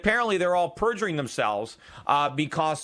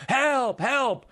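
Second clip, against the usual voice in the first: much higher mock cry for help; man under threat imitating a cry for help, higher; pretend cry for help; 9 semitones higher than usual. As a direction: call out for help, a bit hammed-up. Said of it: "You wouldn't just scream incoherently".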